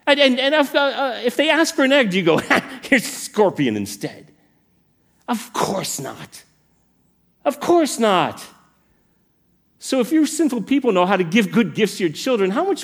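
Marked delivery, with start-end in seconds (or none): 0.0-4.3 stammering high disbelief, then a laughed punchline; mock-baffled, half-laughing question; incredulous Jesus. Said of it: "do you hear the incredulity in his voice". 5.3-8.4 drawn-out, emphatic dismissal; slow, incredulous rebuttal; incredulous Jesus. Acted. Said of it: "the incredulity in his voice".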